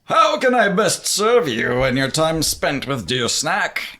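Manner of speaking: slow, grand genie butler voice; formal servant genie, unhurried. Acted. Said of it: "he comes out and he says".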